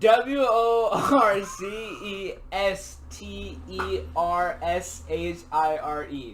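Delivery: slow, loud rhythmic spelling; like hitting bars. Real, not acted. Spelling it out for friends, slow and loud like hitting bars. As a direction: punch out each letter like rap bars. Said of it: "like you were hitting some bars".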